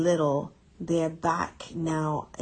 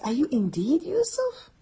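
much higher, amazed asking; the brothers ask in amazement whether he is Yusuf; astonished question; 10 semitones higher than usual. Retold or imitated. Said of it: "emphasis upon emphasis".

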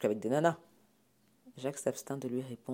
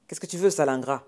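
loud, contemptuous parent; angry demand; 3 semitones higher, 9 dB louder than usual. Acted. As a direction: lash out at an ungrateful son. Parent berates frightened son. (sommation furieuse)

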